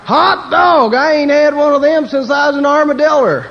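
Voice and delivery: shouted, very high Texas hollering with laughter; brash Texas yell, laughing; loud Texan. Retold or imitated. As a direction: whoop like an excited Texan. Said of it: "get to the Texan".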